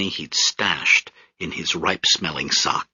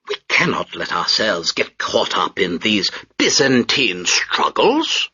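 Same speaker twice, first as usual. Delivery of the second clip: pompous British major; 5 semitones higher than usual. Acted. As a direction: sneer down at the crowd from the deck.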